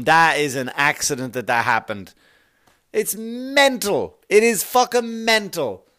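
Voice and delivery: normal talking voice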